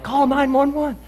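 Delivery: high-pitched urgent yell. Retold or imitated. Yelling at a coworker to call nine one one, high and urgent. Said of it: "I yell at some guy".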